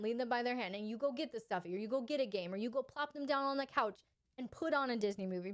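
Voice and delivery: ordinary delivery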